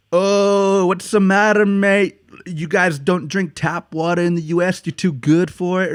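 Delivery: high-pitched mocking imitation; taunting French guy. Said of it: "Trying to be a dick".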